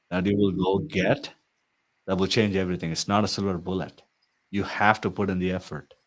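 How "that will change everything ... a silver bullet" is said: normal talking voice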